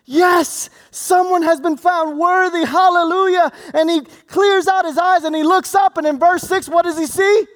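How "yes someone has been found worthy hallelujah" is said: excited. Retold or imitated. John overjoyed at news of a worthy one, excited shout. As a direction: burst out with joy, nearly shouting. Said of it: "He got excited".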